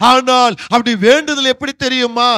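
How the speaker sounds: normal talking voice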